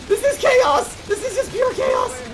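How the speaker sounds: very high, strained-with-panic cry; panicked. Real, not acted. Player overwhelmed by zombie swarm, panicked cry. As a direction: wail it at the top of your range.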